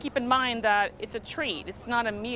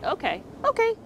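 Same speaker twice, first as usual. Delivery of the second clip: bright praise; 9 semitones higher than usual. Real, not acted. Releasing the dog, praising.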